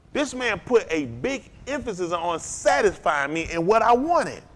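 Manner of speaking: ordinary talk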